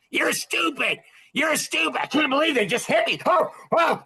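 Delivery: high, mocking rant at other drivers; mock yelling. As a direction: ham up an angry driver's outburst.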